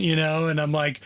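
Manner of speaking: ordinary talk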